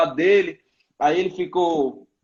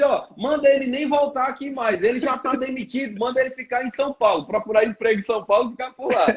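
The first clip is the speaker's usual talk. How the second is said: higher, hammed-up pastor firing him; mock firing; 6 semitones higher than usual. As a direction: play the boss telling everyone he's fired. (demissão em tom exagerado, voz mais aguda)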